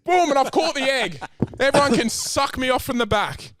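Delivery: high, loud gloating yell; defiant yell. Acted. Gloating at his co-host in a high, loud yell. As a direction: crow over everyone. Shouting during the scamming argument.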